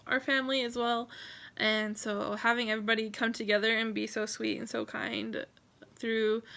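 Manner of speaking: ordinary talk, nothing marked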